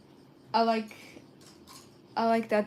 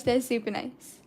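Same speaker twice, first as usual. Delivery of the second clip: normal talking voice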